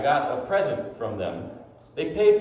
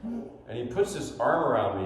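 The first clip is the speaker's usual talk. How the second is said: ordinary talk, nothing marked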